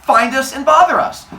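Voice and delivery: halting, with loud high bursts; acted cover story, punched after pauses; Zeus. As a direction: invent the excuse bit by bit. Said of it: "He goes".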